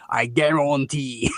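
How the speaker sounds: put-on Australian accent. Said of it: "goes around saying".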